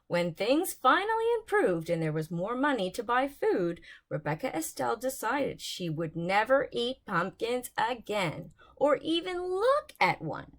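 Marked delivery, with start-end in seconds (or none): none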